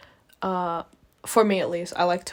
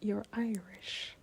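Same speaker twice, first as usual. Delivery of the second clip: barely audible whisper, slow; hushed, voiceless whisper; 13 dB quieter, much slower than usual. Real, not acted. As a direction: whisper it as an aside. Hushed aside about being Irish.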